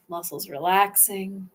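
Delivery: ordinary talk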